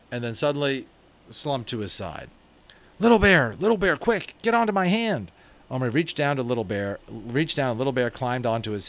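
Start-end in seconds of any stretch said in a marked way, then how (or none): 3.0-5.3 put-on high, loud and urgent, a boy calling for help; panicked boy calling his friend. A narrator's reading. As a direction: call him over in a hurry. Omri calls in alarm.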